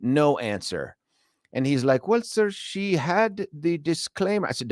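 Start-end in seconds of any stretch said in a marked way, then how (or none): 2.1-4.5 higher, slow put-on agent voice; mock agent voice. Support agent to frustrated customer in a high, slow put-on voice. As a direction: play the smug support agent, dragging it out. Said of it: "pretend accent".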